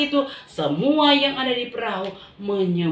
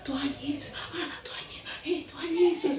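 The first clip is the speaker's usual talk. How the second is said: awed whisper; 4 semitones higher, 8 dB quieter than usual. Acted. Disciples awed by Jesus.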